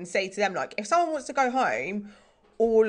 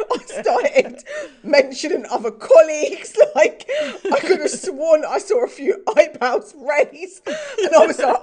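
real laughter, higher and louder; laughing; 7 semitones higher, 8 dB louder, slower than usual. Real, not acted. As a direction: giggle through the embarrassing memory. Laughing at her own rambling story.

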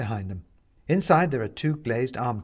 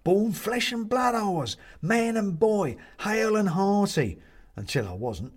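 high, slow, rolling recollection; cheerful; 10 semitones higher, slower than usual. A narrator's reading. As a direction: reminisce breezily, dropping at the end. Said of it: "says the man cheerfully".

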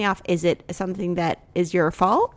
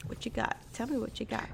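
hushed, barely voiced prompt; playful whisper; 3 semitones higher, 12 dB quieter than usual. Real, not acted. Wife prompting husband for his answer, whispering. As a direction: coax in a whisper.

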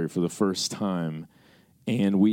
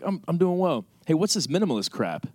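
higher-pitched mom voice; imitating mom; 8 semitones higher than usual. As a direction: play a mom questioning her son in a raised pitch. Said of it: "She's like".

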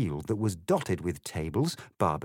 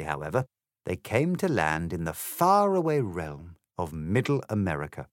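plain reading voice

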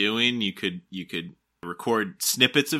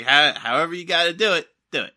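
mock advice voice; 7 semitones higher, 6 dB louder, faster than usual. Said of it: "my advice is, like".

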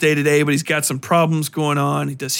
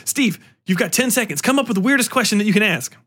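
pushy, fast, higher producer; 6 semitones higher, faster than usual. Retold or imitated. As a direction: rush him along, pitched up. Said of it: "It's like".

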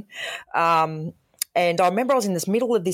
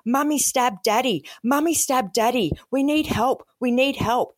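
put-on higher, urgent, panicky little-kid plea; imitating a hysterical child; 8 semitones higher than usual. Small boy on the phone to the call taker, hysterical pitched-up plea. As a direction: echo a four-year-old's frantic cry for help. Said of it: "He was hysterical".

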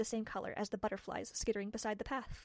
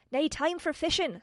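wee Scottish man; 7 semitones higher, 6 dB louder than usual. Acted. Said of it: "said the first man".

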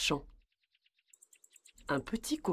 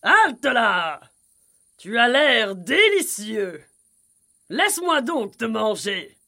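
loud, higher horse-character call; hungry horse; 5 semitones higher, 8 dB louder than usual. A narrator's reading. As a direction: halt them with a big greedy shout. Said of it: "un cheval qui hennit".

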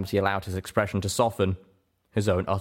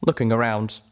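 plain reading voice

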